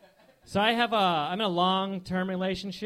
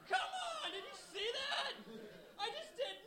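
giddy magician; 12 dB quieter than usual. Retold or imitated. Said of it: "I would be like".

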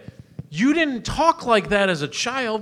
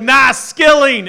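loud, slow, exaggerated Chicago accent; cartoon Chicago accent; 7 semitones higher, 12 dB louder, much slower than usual. Host imitating the guest's Chicago news voice. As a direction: ham up the thick Chicago accent. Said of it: "you sounded like a goddamn cartoon".

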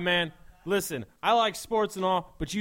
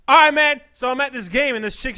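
higher, louder dude-bro voice; 8 semitones higher, 11 dB louder than usual. Acted. Bro telling his buddy about a girl, loud. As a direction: crank up the frat bro.

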